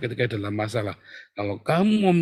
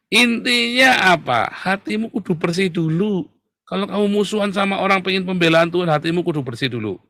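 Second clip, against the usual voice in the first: preaching at full force; 7 semitones higher than usual. Real, not acted. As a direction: drive the main point home, high and insistent. (berkhotbah dengan nada tinggi dan tegas)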